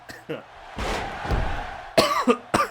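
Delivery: harsh choking cough; coughing fit. Real, not acted. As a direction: cough up a swallowed fly. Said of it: "a fly just flew in my mouth".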